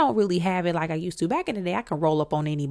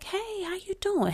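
soft, very high imitated hello; imitated singer's greeting; 13 semitones higher, 4 dB quieter than usual. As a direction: greet in a light, lifted put-on voice.

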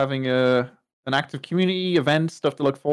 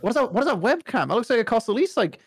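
excited; 8 semitones higher, faster than usual. Real, not acted. Excited about webcam.

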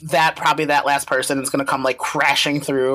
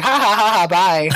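higher, louder acted laugh into a goodbye; mock gloating laugh; 5 semitones higher, 4 dB louder than usual. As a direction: imitate gloating over sinking enemies. Retold gloating laugh in dream.

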